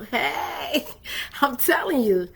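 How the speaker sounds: ordinary delivery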